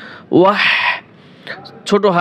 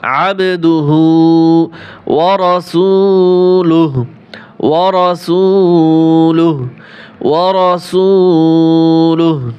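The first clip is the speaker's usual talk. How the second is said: slow Quranic recitation; 4 dB louder, much slower than usual. Shown as a demonstration.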